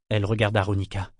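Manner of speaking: usual speaking voice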